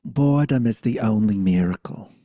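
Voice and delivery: loud Brooklyn grandma pronouncement; Brooklyn accent. Retold or imitated. Grandmother teaching a child, loud Brooklyn accent. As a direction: declare it firmly in a Brooklyn accent. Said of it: "'cause she had a Brooklyn accent".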